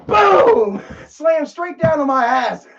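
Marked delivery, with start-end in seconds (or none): 0.1-0.8 high loud boom. Retold or imitated. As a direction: belt out the impact sound. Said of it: "And I go".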